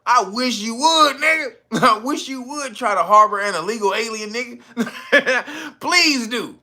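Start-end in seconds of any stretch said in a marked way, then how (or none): 0.1-1.5 1 gloating dare. Retold or imitated. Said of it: "he was like".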